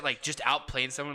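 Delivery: ordinary delivery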